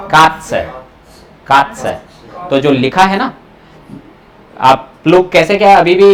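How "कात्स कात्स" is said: कात्स with a short half a and ts; German pronunciation. Shown as a demonstration. Teacher repeats कात्स for the class. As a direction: clip the a in कात्स, finish on ts. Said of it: "यहाँ ए आधा आ जाएगा".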